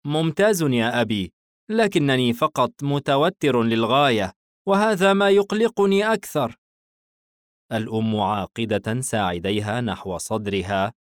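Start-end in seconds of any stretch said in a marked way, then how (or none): 0.1-6.6 put-on higher-pitched boy addressing his father; young son. Son telling his father about exam nerves, high anxious boy voice. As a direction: voice a son, pitch climbing as he goes. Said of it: "الابن ممسكا كف والده".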